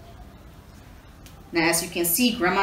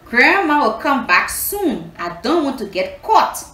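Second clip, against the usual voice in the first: worried thought; 5 semitones higher, 5 dB louder than usual. Acted. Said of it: "Anansi thought".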